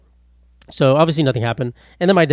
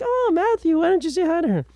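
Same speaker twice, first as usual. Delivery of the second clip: much higher, sing-song mock of mom. Son mocking his mom's nudge, high sing-song. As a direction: squeak it out like a teasing mom. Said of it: "She's like".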